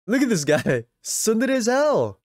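teasing. Real, not acted. Teasing a character.

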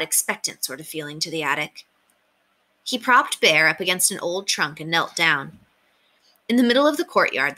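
ordinary delivery, nothing marked

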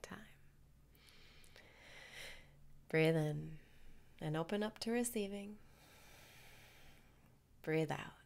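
calm guru voice leading the breathing; guided meditation. Acted.

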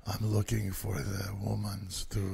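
hushed, whispery creep; creepy serial killer voice. Retold or imitated. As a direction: whisper it like a slasher. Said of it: "that's how he talked, really creepy".

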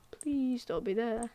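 hushed, slow, nervous hope; quiet plea. Real, not acted. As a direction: barely breathe the words, praying. Hoping quietly, then pleading louder.

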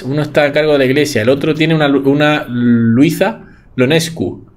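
ordinary talk

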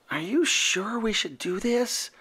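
nervous little whisper; hushed anxious whisper. A narrator's reading. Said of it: "whispered Furball".

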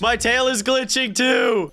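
high-pitched cry of alarm; high, panicky exclamation; alarmed yell. Real, not acted. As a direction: cry out in dismay. Alarmed by own glitching tail.

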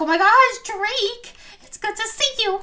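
put-on high-pitched playful voice for a little girl; child's excited greeting. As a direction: greet a friend in a high, gleeful voice. Voices Malia greeting Drake.

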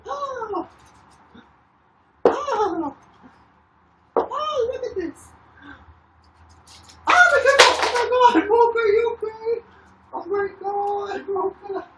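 real panic, very high, crying out; shrieking in fright over the hurt dog; panicked shrieks. Real, not acted. Owner panicking as her dog gets hurt, high frantic cries.

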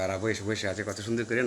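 ordinary talk, nothing marked

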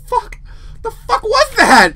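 loud, high, drawn-out disbelief; rattled after a real fright; shaken yell. Real, not acted. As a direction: cry out slowly in shocked alarm. Still shaken by jump scare.

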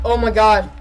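loud, real burst of joy; thrilled. Real, not acted. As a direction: yell it at the diamonds. Found diamonds.

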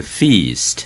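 feast with a long drawn "ee" sound; slow feast, stretching the long E. Shown as a demonstration. Teacher showing the long E sound in feast. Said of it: "读E的长音".

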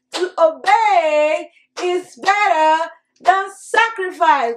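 slow, deliberate verse recitation; slow group recitation. Shown as a demonstration. Teacher repeating the verse slowly with children. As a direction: say every word slowly with the class. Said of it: "One more time".

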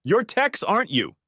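high-pitched yell without much extra volume; narrator's contained yell; restrained yell. A narrator's reading. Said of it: "he was still yelling".

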